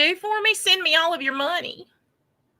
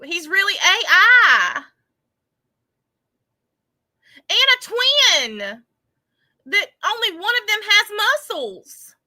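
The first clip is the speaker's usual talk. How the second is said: mock distraught; 6 semitones higher, 6 dB louder than usual. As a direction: pitch it up like a hammed-up meltdown. Mock distress over absurd rumors.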